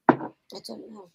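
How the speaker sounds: breathy whisper, slow. Real, not acted. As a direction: whisper it awkwardly. Awkward at rude question.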